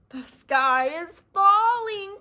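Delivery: loud, slow breathy frightened chorus; all the birds at once, panicked; breathless chorus. Acted. Birds telling the officer, slow frightened chorus. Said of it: "chorused the birds".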